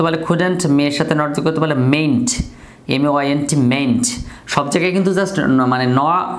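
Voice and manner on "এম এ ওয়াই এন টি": "এম এ ওয়াই এন টি" named letter by letter; spelling out. Shown as a demonstration. Teacher spells mayn't for students, "এম এ ওয়াই এন টি" letter by letter.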